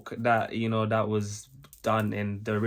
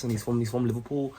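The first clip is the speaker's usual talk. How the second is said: put-on light Scouse footballer accent; Steven Gerrard Scouse. Reactor explaining the footballer to viewers, lightly put-on Scouse accent. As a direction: slip into a Liverpool Scouse lilt. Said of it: "he talks like this".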